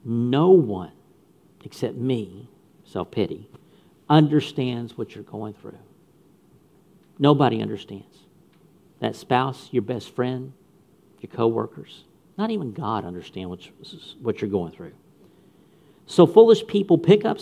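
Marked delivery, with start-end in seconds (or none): 0.0-5.8 low, soft, lightly voiced tempter; a hint of a sly little devil whispering comfort; evil self-pity voice. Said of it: "in its evil voice". 7.2-14.9 low, much softer, sulky murmur; a hint of a pitiful voice consoling you; whiny self-pity voice.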